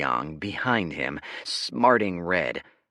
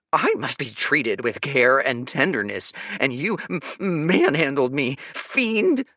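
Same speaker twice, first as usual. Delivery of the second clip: teary outrage; 8 semitones higher, 7 dB louder than usual. Acted. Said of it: "his eyes glistening".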